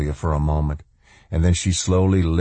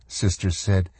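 ordinary delivery, nothing marked

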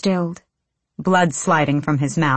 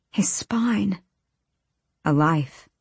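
hushed, whispered repeat of SPINE; breath-only, soft realization; 4 dB quieter, slower than usual. A narrator's reading. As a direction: whisper "His spine" as dread sinks in. Horrified repeat of the words.

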